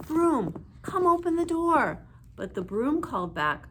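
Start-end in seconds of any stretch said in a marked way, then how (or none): 0.1-2.1 high, loud call. A narrator's reading. Fairies calling the broom to open up.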